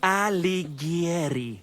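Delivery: Alighieri said slow and precise, Italian way; Alighieri, slow, every syllable pronounced; correcting pronunciation. Acted. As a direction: spell out Alighieri with Italian care. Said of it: "he thinks he's Dante Alighieri".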